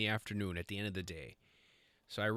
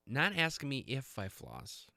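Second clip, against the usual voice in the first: ordinary talk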